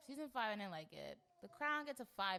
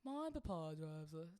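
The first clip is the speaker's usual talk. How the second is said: a mock British bottle of water; hammed-up London accent, glottal t; lightly voiced British accent; 3 semitones higher, 4 dB louder than usual. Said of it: "Bottle of water".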